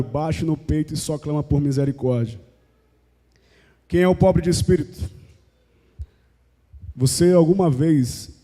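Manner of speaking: ordinary talk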